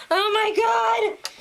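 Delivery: high, drawn-out wail of sympathy; emotional. Real, not acted. Fan recalling the dying dog, high emotional wail. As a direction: exclaim it slowly, overcome by the sadness. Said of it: "I'm getting emotional just thinking about it".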